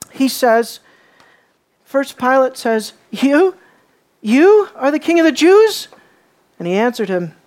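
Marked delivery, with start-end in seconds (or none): 3.1-5.9 higher and louder, scornful question; mocking disbelief. Pilate taunting the accused Jesus, scornful disbelief. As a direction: sneer the you with contempt. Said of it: "a mocking statement".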